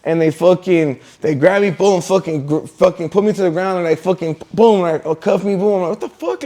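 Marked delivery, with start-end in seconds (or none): 5.9-6.4 high, softer yelp of disbelief; retold shock. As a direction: squeak out your disbelief. Retelling shock at being cuffed.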